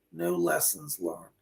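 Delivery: low, hushed repeat; soft low echo. Retold or imitated. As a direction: let it fade away like an echo. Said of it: "She just said".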